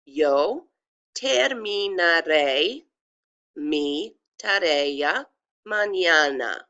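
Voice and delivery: slow, careful Spanish with stress on the final é of "terminaré"; pronunciation demo. Shown as a demonstration. Teacher pronouncing a sentence for students, slow careful demo. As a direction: sound every letter of "Yo terminaré mi tarea mañana", word by word. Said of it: "it's pronounced like this".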